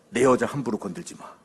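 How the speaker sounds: low, contained fatherly warning; stern warning. Acted. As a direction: warn him firmly without raising your voice. Father's first low warning to son.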